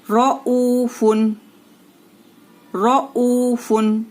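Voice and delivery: रऊफुन drawn out very slowly with a thick, heavy R; very slowly, रऊफुन with a full ra and nasal un. Shown as a demonstration. Teacher chanting a Quran word slowly for students to repeat. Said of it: "इस तरीके से रॉ को मोटा पुर पढ़ेंगे".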